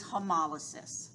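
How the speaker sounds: hemolysis said slowly with the stress on the second syllable, he-MOL-ysis; word stress shown. Shown as a demonstration. Teacher slowly shows students where hemolysis is stressed. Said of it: "the emphasis in the pronunciation".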